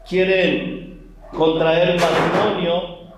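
ordinary delivery

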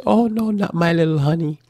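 lightly voiced doting parent; mock doting parent. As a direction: coo over the little honey.

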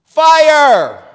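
full, high alarm yell; shouted alarm. Acted. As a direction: yell a warning to a crowded room. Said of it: "someone shouted in a crowded theater".

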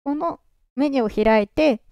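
usual speaking voice

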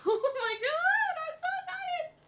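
very high, laughing squeal; giddy squeal. Real, not acted. Thanking Hannah for makeup she'll cherish, giddy squeal. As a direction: squeak it out, overcome with glee.

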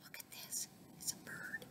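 hushed, barely-there whisper; whispered aside. Real, not acted. Reader points out the bird to listeners, whispered aside.

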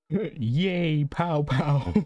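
high, playful pow pow gun sounds; childlike mouth gunfire; gun noises. Pretend. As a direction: make your own gunshot noises, squealing high. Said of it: "makes gun noises when I shoot".

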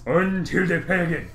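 lightly voiced mock ninja; a hint of a cartoon ninja; weird ninja voice. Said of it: "talk in the weird ninja voice".

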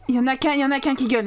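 fast, breathless rattled-off count; rapid rattle. Real, not acted. Player counting screaming babies, rattled off fast. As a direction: fire it off fast. Repeats the line.

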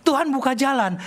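normal talking voice

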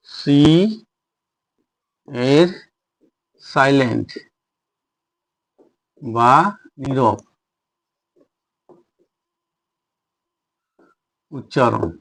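long pauses between words, voice dropping; measured dictation, one word at a time. Shown as a demonstration. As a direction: dictate it slowly as you write. Dictates the heading while writing.

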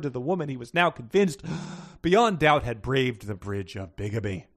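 grand, theatrical read-aloud; dramatic reading. Acted. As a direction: read it like purple prose. Reads an overwrought sentence aloud.

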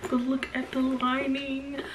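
high, excited sing-out; thrilled, high-pitched showing off; excited gushing. Real, not acted. Showing off the bag's lining.

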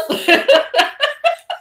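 a long, high burst of laughter. Real, not acted. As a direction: crack up at your own story. Laughing at her own story.

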